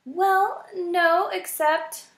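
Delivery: high, small mouse voice, scared; a timid mouse answering the porcupine; frightened little mouse. A narrator's reading. Poppy hesitating.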